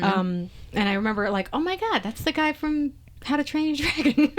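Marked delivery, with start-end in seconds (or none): 1.5-4.3 high-pitched, amazed realization; playful gasp of recognition, pitched up; retold excited recognition. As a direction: act out suddenly spotting a familiar actor. Retells recognizing the actor.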